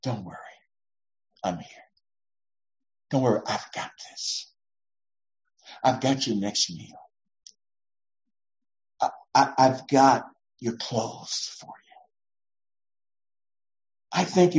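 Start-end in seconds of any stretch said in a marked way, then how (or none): 0.0-12.0 tender reassurance. Pretend. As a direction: comfort a fearful child.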